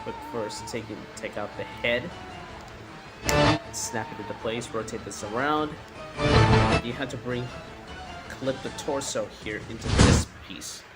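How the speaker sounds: ordinary delivery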